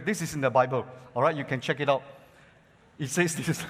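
normal talking voice

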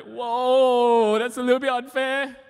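a high mock-offended reply to mom; mock protest. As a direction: play hurt surprise at the unfair blame. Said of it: "I'm like".

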